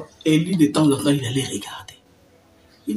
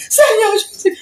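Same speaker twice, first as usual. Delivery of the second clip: a much higher, louder cry, half laughing; panicked cry; 9 dB louder than usual. Retold or imitated. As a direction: cry out to the Lord in fright. Cri effrayé devant le lézard. (cri paniqué, très aigu, mi-rire)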